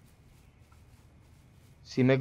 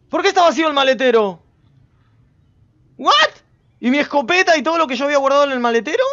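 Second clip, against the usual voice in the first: high, incredulous outburst; shocked disbelief; 7 semitones higher, 4 dB louder, faster than usual. Real, not acted. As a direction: demand an answer in rising alarm. Finds the car trunk empty.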